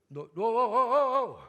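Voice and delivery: loud, rising whoa to halt the throw; alarmed stop. Acted. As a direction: freeze him mid-throw.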